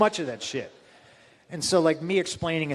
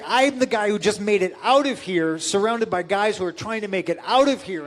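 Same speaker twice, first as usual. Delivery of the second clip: louder, raised heated protest; 6 semitones higher, 5 dB louder than usual. Retold or imitated. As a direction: argue your case hotly. Retold confrontation, pleading case.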